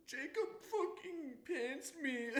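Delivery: slow, high hammed-up wail; mock bawling. Kid bawling about being pantsed, mock wailing. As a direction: drag it out like a kid blubbering, played for laughs. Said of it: "bawling his eyes out".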